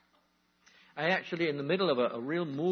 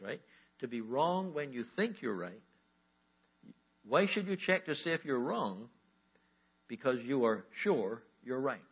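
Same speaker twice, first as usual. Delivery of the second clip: slow, deliberate reread; slow reading; 4 dB louder than usual. Shown as a demonstration. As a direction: read it slowly so it sinks in. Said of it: "I want to read this again slowly to you".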